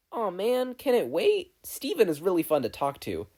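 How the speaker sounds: a hint of bubbly younger sister pleading; Mabel voice.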